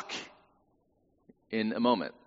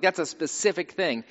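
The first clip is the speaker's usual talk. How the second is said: usual speaking voice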